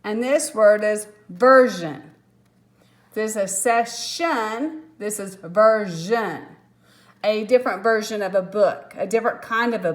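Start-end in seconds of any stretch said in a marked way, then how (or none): 3.6-4.8 "session" slowly, split as SESS-SHUN; shun ending. Shown as a demonstration. Teacher contrasts two endings, "session" slowly as sess-shun. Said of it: "it does not make the shun sound". 5.3-6.4 "version" slowly, split as VER-ZHUN; zhun ending. Shown as a demonstration. Teacher contrasts two endings, "version" slowly as ver-zhun. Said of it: "It makes the zhun sound".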